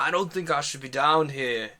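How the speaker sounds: loud, badly faked Scottish voice; bad Scottish accent. Uneasy ranger to the party, loud shaky-grasp Scottish accent. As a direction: attempt a Scottish ranger, firmly. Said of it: "This is a terrible version of this person's voice".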